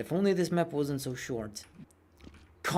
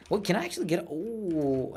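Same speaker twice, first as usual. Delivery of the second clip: usual speaking voice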